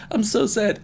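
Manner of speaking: high squeaky mock of the quote; mocking squeak. Shown as a demonstration. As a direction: squeak it out, making fun of the line. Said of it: "Why does it sound like".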